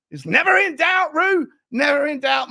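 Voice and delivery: high, loud victory cry; triumphant shout. Real, not acted. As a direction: crow it out in triumph. Triumphant reply to fan super chat.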